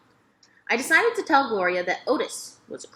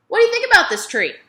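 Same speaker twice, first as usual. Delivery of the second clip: put-on old woman's question; 12 semitones higher, 8 dB louder, faster than usual. A narrator's reading. Gloria asks, voiced high.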